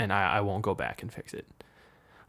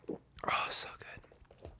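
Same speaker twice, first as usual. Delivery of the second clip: whisper; 11 dB quieter, much slower than usual. Real, not acted. After a sip of Monster.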